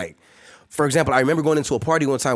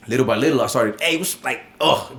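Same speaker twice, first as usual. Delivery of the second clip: normal talking voice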